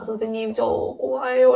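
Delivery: slow, mock tearful confession; a hint of sobbing, said slowly; imitating a crying student. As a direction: lightly play the boy breaking down. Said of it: "이래서 울더라고".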